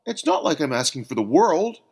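high, loud exclamation; emphatic outcry; exclaiming. A narrator's reading. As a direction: burst out in protest. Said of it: "exclaimed Bill".

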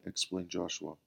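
usual speaking voice

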